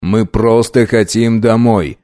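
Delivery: plain reading voice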